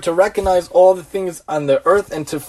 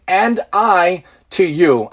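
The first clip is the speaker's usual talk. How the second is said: sounding out words; much slower than usual. Shown as a demonstration. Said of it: "we're basically saying".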